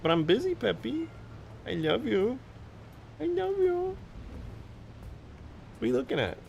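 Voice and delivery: high, cutesy pet voice; doting, squeaky-high questions to a cat. Real, not acted. Streamer baby-talks to the cat.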